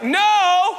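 high, loud "No!"; a sharp shouted no; yelled answer. Retold or imitated. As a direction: shout it back across the water. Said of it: "They yell back".

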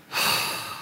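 heavy exhale in mock exasperation; long weary sigh. Jesus reacts to Peter's question, weary sigh. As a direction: let out a long, breathy sigh. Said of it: "This is what I think Jesus went".

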